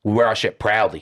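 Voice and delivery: ordinary talk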